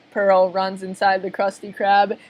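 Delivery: ordinary delivery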